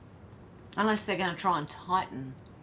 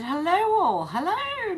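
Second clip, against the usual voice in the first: much higher, drawn-out greeting; singsong hello; 12 semitones higher, 7 dB louder, slower than usual. Real, not acted. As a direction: sing the hello up high to the chat. Greeting chat member in singsong.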